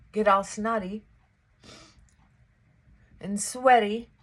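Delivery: ordinary talk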